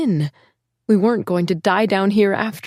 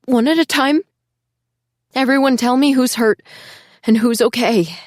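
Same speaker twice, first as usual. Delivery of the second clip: calling out; 5 semitones higher, 4 dB louder than usual. Acted. Calling to children in dark.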